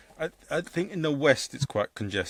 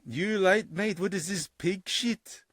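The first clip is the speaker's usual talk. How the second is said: higher, slow mocking foreign voice, imitating the clown; put-on foreign accent; 9 semitones higher, slower than usual. Clown heckling a Glasgow crowd in a put-on higher accent. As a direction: drawl it out in a strange put-on accent. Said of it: "so I'll do another accent".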